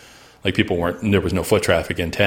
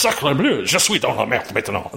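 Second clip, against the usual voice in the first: higher voice, hammed-up French exclamation; French Canadian accent; 4 semitones higher than usual. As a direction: play a panicked French Canadian logger. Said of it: "and it was in French".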